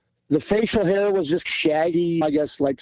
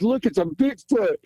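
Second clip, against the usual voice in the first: higher-pitched retold call-out, no real volume; retold yell; 7 semitones higher than usual. Hunter retelling his call to dad about a sighting, mock yell. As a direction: recall an excited yell without shouting. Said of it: "I yelled at my dad".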